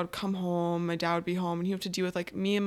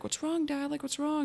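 concerned younger sister; 8 semitones higher than usual. Retold or imitated. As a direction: imitate a younger sister checking on Dad, pitched up. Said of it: "my sister would be like".

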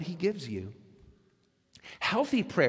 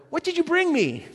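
put-on high, demanding kid voice; mock child asking for gifts; greedy child; 12 semitones higher, 5 dB louder than usual. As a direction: whine it like a grabby child. Child greeting returning parent.

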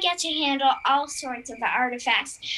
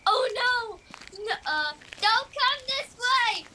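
higher, urgent stay-away calls; alarmed warning; 9 semitones higher than usual. Acted. Luna startled at night, warning strangers to stay back. Werewolf warns intruders away.

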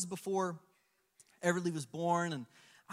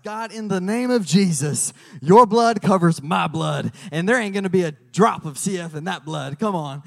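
fervent prayer; 11 dB louder than usual. Retold or imitated. Father praying over his blood test, fervent and loud. Retold prayer declaring against illness.

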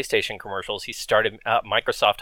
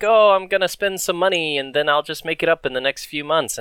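naive self; 5 semitones higher than usual. Retold or imitated. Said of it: "You think".

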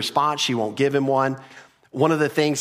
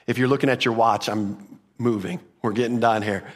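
ordinary talk, nothing marked

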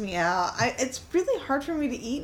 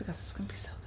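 whispered excitement; 2 semitones lower, 12 dB quieter, much faster than usual. Retold or imitated. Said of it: "And I was like".

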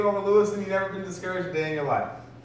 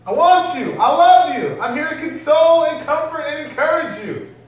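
high, loud, pleading call; a pleading Holy Spirit calling out for attention; Holy Spirit's plea; 8 semitones higher, 10 dB louder than usual. Acted. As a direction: cry out like a lover who is being ignored. Holy Spirit voiced pleading in room.

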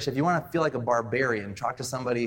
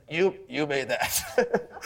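slow, drawn-out answer with a laugh; amused reveal; much slower than usual. Retold or imitated. Host telling guest who made the salad, amused. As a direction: let it out slowly, amused.

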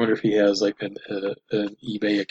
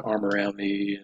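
ordinary talk

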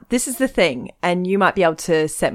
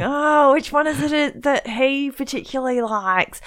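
confused musing; 7 semitones higher than usual. Retold or imitated. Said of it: "I was like going".

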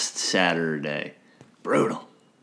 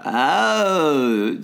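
E.T. voice; 5 semitones higher than usual. Retold or imitated. Imitating E.T. line.

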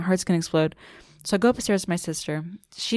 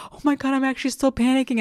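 high-pitched mock alarm; retold panic; 6 semitones higher than usual. Stoned woman slipping back into panic mode, mock alarm. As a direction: raise the pitch as panic returns. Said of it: "go back into the panic mode".

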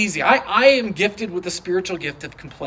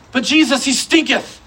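mock complaint; 5 semitones higher than usual. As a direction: play the disciples griping that he stinketh, half amused. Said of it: "say stinketh and not giggle".

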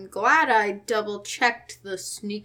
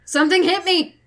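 alarmed shout; 7 semitones higher, 5 dB louder than usual. Acted. Character cries out when attacked.

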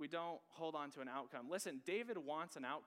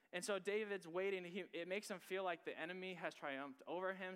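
preaching; 5 semitones higher, 3 dB louder than usual. Acted.

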